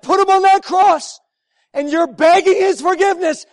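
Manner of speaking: rising, loud pulpit preaching; fervent preaching. Acted. Preacher pressing the congregation about Christ's forgiveness, loud and fervent. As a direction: drive the point home with full force. Preaching builds to full force.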